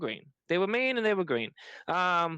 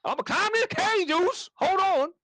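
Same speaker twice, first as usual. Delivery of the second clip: mocking Jey; 8 semitones higher than usual. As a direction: imitate a wrestler gasping mid-match, pitched up. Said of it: "and you say".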